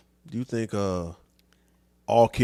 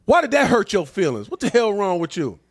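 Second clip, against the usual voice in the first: higher and louder, scornful brush-off; hammed-up macho dismissal of someone's feelings; mocking tough guy; 7 semitones higher, 5 dB louder than usual. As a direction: scoff at him like a hard man with no patience. Mocking dismissive man's reply.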